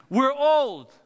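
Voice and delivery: loud, high outcry; indignant protest. Acted. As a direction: blurt it out as an exasperated complaint. Blunt protest as Zechariah.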